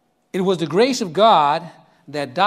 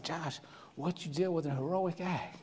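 quiet and much softer, proud; tender praise; 5 semitones lower, 17 dB quieter than usual. Retold or imitated. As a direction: praise him gently and sincerely.

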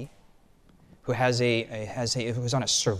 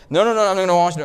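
raised, insistent no, no, no; loud mock refusal; 5 semitones higher, 8 dB louder, much faster than usual. Disciple refusing to wash the others' feet, loud and insistent. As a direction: push the job away loudly, half-joking.

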